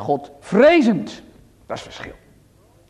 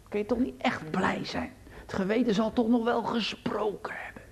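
whisper; 3 semitones higher, 10 dB quieter than usual. Real, not acted. Appeals to youth's conscience.

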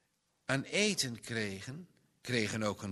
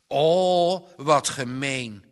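put-on boy's loud indignant exclamation; indignant cry; 12 dB louder, slower than usual. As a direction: cry out at the unfairness. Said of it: "riep Johannes verontwaardigd".

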